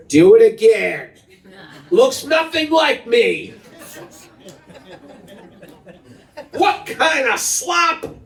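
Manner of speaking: high, slow sneering critic dad; mock harsh dad. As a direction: scoff at the kid's drawing. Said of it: "What does a good dad say?".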